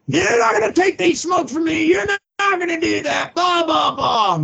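high, whiny protest at God; mocking himself. Man mimics his old self whining at God, high and petulant. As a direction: pitch way up, whine like a kid losing his smokes.

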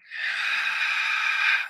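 long breathy sigh out through the mouth; demonstration sigh. Shown as a demonstration. Guide showing the listener how to sigh, audible exhale. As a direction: let the breath fall out in a sigh. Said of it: "And then sighing".

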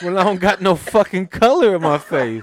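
high, loud comic exasperation; hammed-up outraged complaint; indignant outburst. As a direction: protest at being laughed at. Retold indignant outburst at laughing wife.

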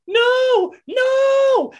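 screaming no in high-pitched terror; hammed-up horror movie victim; mock scream. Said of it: "and she's like".

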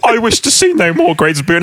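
high, overacted Scrooge plea; Michael Caine. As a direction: play Scrooge far too seriously. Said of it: "he is a serious actor".

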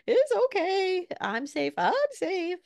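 put-on high, drawn-out little-kid voice; upset child self-soothing. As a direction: imitate a toddler wailing words of comfort. Child repeats calming words when upset.

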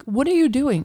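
retold sharp question. Confronting the man closing windows, loud and sharp. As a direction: snap the question at him. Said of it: "And I'm like".

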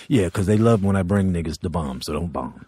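low, fast, offhand mumble; lazy Paul voice. Retold or imitated. Paul invites the comic on tour, low and offhand. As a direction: toss it off like a casual offer. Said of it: "He goes".

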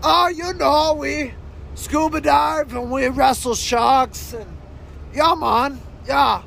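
high-pitched, easygoing adventurer character; high goofy guest voice; laid-back shark-wrestling guest. Acted. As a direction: put on a high voice for a casual daredevil.

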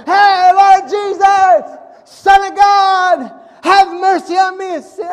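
slow, drawn-out yell, high and loud; desperate shout. Retold or imitated. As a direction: call out with everything you have. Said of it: "they shouted all the louder".

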